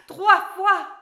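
storyteller hits the count of three; dramatic climax. A narrator's reading. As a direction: build to the magic moment.

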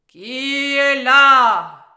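huge, drawn-out troll roar; growling troll. A narrator's reading. Troll calls out when Alice knocks at his cave, growling. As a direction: bellow it, stretching every word. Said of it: "grogna le troll".

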